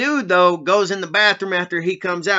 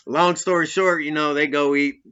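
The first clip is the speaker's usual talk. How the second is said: normal talking voice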